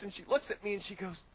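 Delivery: usual speaking voice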